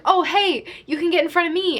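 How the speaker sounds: high, chirpy mock offer; eager friendly shopper. Filipino lady offers girl her spot in line, high eager imitation. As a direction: imitate an over-eager stranger hailing someone.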